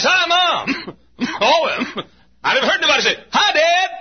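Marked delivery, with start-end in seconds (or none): 0.0-1.0 high, exasperated mimicry; exaggerated high-pitched TV greeting; mocking quote. 3.3-3.9 high, exasperated mimicry; exaggerated high-pitched TV greeting; mocking quote. The greeting he never hears.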